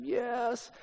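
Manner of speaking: high, soft tearful little voice; mock crying. Lost toddler answers a stranger, mock crying. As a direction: imitate a lost child's weepy answer. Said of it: "he starts crying".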